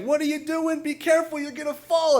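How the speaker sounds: high-pitched mock yell of warning; retold yell. Neighbor to man hanging off the fire escape, mock yelling. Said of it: "she's yelling".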